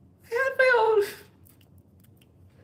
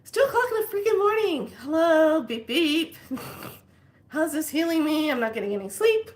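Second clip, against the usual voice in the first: high, fast exasperation; fed up at two in the morning; exasperated; 7 semitones higher, 3 dB louder than usual. Retold or imitated. Lying awake at night, high fast exasperation. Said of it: "I'm just like".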